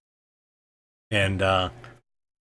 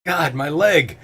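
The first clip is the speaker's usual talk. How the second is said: higher, mock-wounded cry; hammed-up pain; pained groaning; 6 semitones higher, slower than usual. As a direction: groan over a hurt leg. Acts out wounded Walrus.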